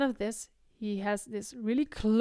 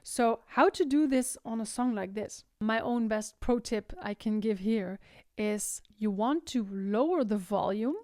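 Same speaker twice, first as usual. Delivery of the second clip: normal talking voice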